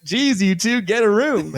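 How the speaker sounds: high, goofy teasing voice; goofy Troy. Retold or imitated. Troy teasing Abed and Robin behind them, high and goofy. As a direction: tease the couple in a high goofy voice.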